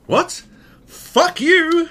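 very high, mock-offended broken English; high broken-English outburst. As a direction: imitate an affronted foreigner in a squeaky pitch. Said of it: "it's super broken English".

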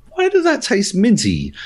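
high-pitched retold bewilderment; puzzled. Customer who swallowed the chewable tablet, puzzled.

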